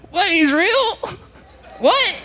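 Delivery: very high squeal of mock-crying astonishment; hammed-up tearful disbelief. Newcomer hearing about Jesus, comically tearful squealed disbelief. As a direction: wail it in exaggerated shock. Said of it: "I don't understand why I'm crying".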